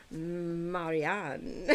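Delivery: "Marianne." said the French way, a drawn-out mar-ee-AHN; a mock French "Marianne."; French-style Marianne. Shown as a demonstration. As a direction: try it as a French speaker would, slow and falling. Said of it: "more in a French way".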